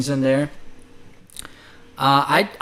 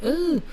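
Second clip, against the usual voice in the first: high-pitched little "ooh" of surprise; short, very high mock ooh; startled ooh; 13 semitones higher, 3 dB quieter than usual. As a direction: imitate recoiling at your own echo with a squeaked ooh. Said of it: "heard myself echoing a little bit".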